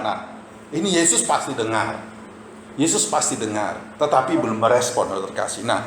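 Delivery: ordinary delivery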